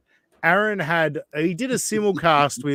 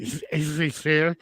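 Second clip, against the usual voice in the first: imitating talking through a mouth guard; mumbled mock mouthpiece voice. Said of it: "talking with the mouth guard in".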